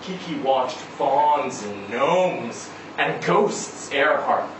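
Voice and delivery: ordinary delivery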